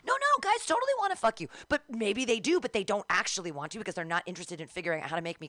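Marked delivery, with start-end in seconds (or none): 0.1-1.5 very high, quick mimicking voice; mock reassurance. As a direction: parody people gushing hurried reassurance. Mimics people reassuring her.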